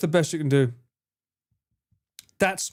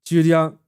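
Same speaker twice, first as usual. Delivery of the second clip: "Julian" held level and louder, without a dip; 5 dB louder than usual. Shown as a demonstration. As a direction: say "Julian" firmly on one even pitch. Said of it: "start the pitch going down and up".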